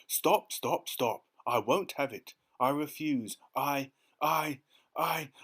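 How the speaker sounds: loud, high stammering protest; panicked protest. A narrator's reading. The terrified earthworm refuses to be bait. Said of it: "cried the earthworm".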